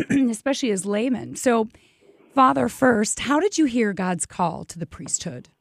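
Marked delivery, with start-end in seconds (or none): none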